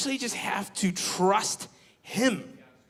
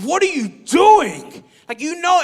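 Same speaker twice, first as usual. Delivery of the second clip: high, loud and slow exasperated yell; mock angry shout; 10 semitones higher, 10 dB louder than usual. Scolding Keenan for calling her over: loud, high mock shout. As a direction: demand an answer from your clueless friend. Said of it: "but I was so mad".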